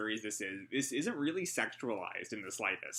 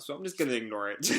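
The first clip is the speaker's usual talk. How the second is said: usual speaking voice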